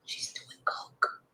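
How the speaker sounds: slow hushed whisper. Real, not acted. Whispering the drug gossip so the child won't hear. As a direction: whisper the scandal slowly. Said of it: "I'm whispering because there's a child".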